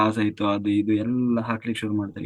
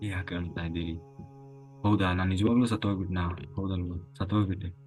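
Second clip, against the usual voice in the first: tearful; 4 semitones lower, 7 dB quieter, faster than usual. Acted. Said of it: "ಇವನು ಅಳ್ಲಿಕ್ಕೆ ಶುರು ಮಾಡ್ತಾನೆ".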